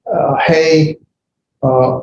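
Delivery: normal talking voice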